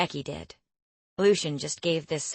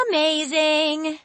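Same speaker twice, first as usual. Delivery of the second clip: high, slow sing-song; 10 semitones higher, 6 dB louder, slower than usual. A narrator's reading. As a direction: draw it out in a lilting tune. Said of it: "Becky said in a sing-song voice".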